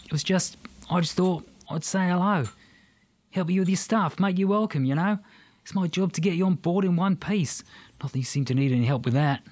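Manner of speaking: high, hurried Aussie excuses; apologetic Aussie bloke; sheepish Australian. Acted. Aussie man explains sheepishly.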